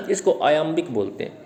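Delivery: ordinary talk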